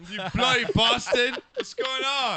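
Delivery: high British insult greeting; mock English accent. Host razzing the caller, mock English accent. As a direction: greet them in hammed-up English. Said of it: "in an English accent".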